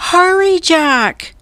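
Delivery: high, loud urgent call; cried out. A narrator's reading. Annie cries to Jack from the ladder. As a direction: hurry him from the ladder. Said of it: "cried Annie".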